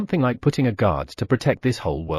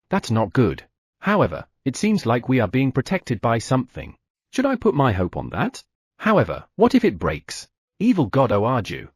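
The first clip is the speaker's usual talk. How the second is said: ordinary delivery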